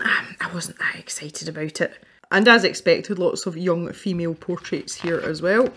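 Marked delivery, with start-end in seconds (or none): 0.0-1.9 hushed confession under breath; whispered aside. Real, not acted. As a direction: whisper it like a secret.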